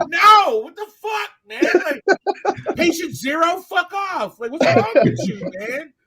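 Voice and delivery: high, loud yelling through laughter; laughing shout. Real, not acted. Friend mocks the pesky beggar to the crew, laughing shout. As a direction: shout it while cracking up.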